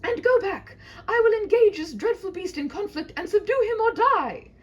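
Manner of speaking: high, loud ringing declaration; heroic vow. Acted. Knight vowing to slay the dragon, high and loud. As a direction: proclaim it like a hero.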